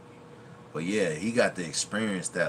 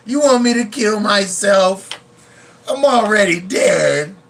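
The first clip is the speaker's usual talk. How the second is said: hammed-up desperate movie line; movie line impression; 11 semitones higher, 13 dB louder than usual. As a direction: beg like a broken junkie. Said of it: "he said".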